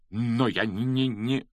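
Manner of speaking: halting, stuttering objection; stammered protest. A narrator's reading. Quirrell cut short by Snape, protesting with a stammer. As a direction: try to protest but get stuck. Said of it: "запротестовал Квирл".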